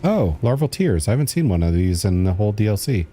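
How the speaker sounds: usual speaking voice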